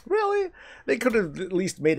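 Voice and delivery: very high mocking disbelief; incredulous. Real, not acted. Player mocking an easy quest hint in disbelief. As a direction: squeak it in disbelief.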